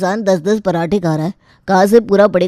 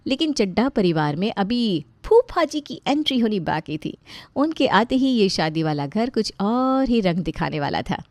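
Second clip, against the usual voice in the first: softer, playful storyteller voice; chatty comic narration, quieter than the characters; story narration; 5 dB quieter than usual. Acted. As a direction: narrate the family comedy to the audience.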